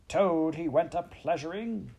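high, indignant yell. A narrator's reading. Toad storming in with injured pride, yelling. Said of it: "he yelled".

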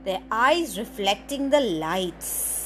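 put-on lively storybook reading for little kids; story narration. Presenter reading a picture book to children, animated storytelling. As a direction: read it out like story time, big and bright.

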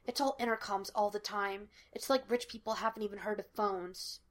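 quiet conspiratorial grumble; whispered. A narrator's reading. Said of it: "Sean whispered".

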